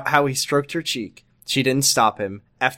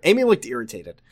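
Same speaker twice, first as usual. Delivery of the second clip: ordinary delivery, nothing marked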